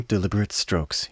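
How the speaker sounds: ordinary talk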